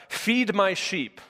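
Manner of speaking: feed my sheep, slow with weight on feed; solemn slow title read; slow announced title. Shown as a demonstration. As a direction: announce the sermon title slowly, leaning on feed. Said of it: "That is the title of today's sermon".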